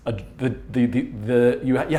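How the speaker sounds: nervous stutter on the words. Real, not acted. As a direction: stumble and repeat nervously. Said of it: "I'm, like, stuttering here because I'm afraid".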